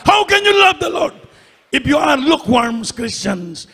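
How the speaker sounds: emphatic preacher, punching the key phrases; impassioned preaching. Real, not acted. Pastor urging the congregation to love the Lord, impassioned preaching. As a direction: preach with rising fervour on "Lord".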